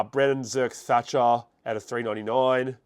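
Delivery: normal talking voice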